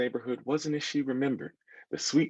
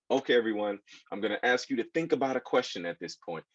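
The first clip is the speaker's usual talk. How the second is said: usual speaking voice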